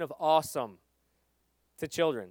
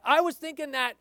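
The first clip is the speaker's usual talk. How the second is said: high, quick claim to credit; defensive disciple; 11 semitones higher, 5 dB louder than usual. Retold or imitated. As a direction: claim the idea like a child. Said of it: "the disciples' conversation".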